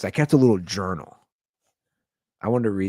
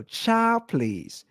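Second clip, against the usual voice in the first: Ocho Cinco quote; 13 semitones higher than usual. Retold or imitated. Quoting a celebrity to dismiss mom's nagging, sassy. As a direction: wave it off with sass. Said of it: "To quote Ocho Cinco".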